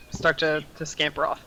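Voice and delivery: usual speaking voice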